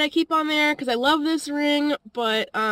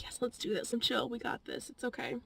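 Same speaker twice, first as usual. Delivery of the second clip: much softer, murmured encouragement to herself; quiet self pep talk; 14 dB quieter than usual. Retold or imitated. Vlogger quietly psyching herself up after losing her job. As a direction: talk yourself up under your breath.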